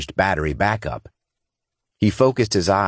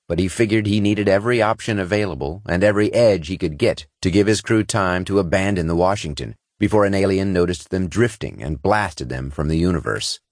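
plain reading voice

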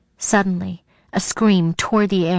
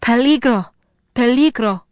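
terrified shout; 4 semitones higher, 4 dB louder than usual. A narrator's reading. Rosa shouting a terrified warning in Sarah's mind. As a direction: shout it in panic, higher. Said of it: "Rosa's terrified voice shouted".

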